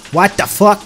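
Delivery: loud, high shout of alarm; startled shout. Real, not acted. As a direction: yell it in real fright. Jump scare in the game.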